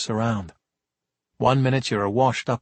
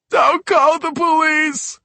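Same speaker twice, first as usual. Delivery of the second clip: put-on much higher, slow childlike wail; crying plea; 5 dB louder, slower than usual. Sobbing kid begs the cops, wailing. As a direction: cry like a toddler. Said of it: "sobbed into his hands".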